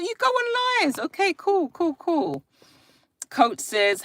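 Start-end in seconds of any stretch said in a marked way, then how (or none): 0.0-2.4 much higher, wide-eyed mock amazement; mock-amazed. Real, not acted. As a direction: play up being impressed. Amused reaction to eight-year-old.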